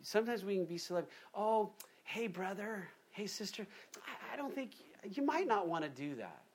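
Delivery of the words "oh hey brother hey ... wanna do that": timidly hint instead of warning; timid hedging. Retold or imitated. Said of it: "we just need to be bold and say".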